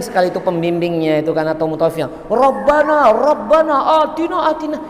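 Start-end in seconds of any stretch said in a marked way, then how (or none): 2.3-4.8 higher-pitched mock prayer cry; chanted-out plea, pitched up; imitating loud prayer. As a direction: mimic a guide calling out the prayer. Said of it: "itu rodok teriak-teriak niku".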